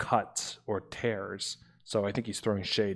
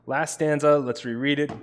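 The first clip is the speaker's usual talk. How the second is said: ordinary delivery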